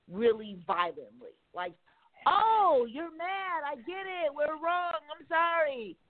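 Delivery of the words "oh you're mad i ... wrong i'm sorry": high, sing-song mock contrition; mock apology. Mocking those who only listen after riots, high and sarcastic. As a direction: sarcastically pretend to finally get it. Mocking people finally understanding.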